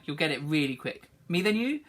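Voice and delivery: ordinary talk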